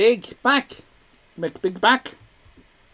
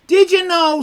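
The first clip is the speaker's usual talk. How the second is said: mock voiced chat message; 10 semitones higher, 8 dB louder than usual.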